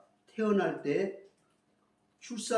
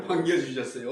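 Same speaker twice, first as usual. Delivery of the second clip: ordinary talk, nothing marked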